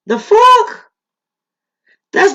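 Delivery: high, outraged disbelief; shocked. Real, not acted. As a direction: blurt it out in shock. Reacting in shock to clip.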